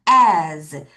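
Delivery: low "As" with a buzzed z; voiced Z ending. Shown as a demonstration. Teacher correcting S, long "As" ending in z. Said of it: "S 아니에요".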